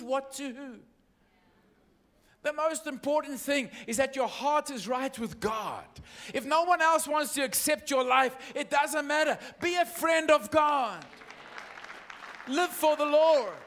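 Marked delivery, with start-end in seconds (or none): 2.4-13.5 loud, high, shouted preaching; preaching at full force. Acted. Preacher exhorting the church to live for God. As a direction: bring it home to the church, full voice.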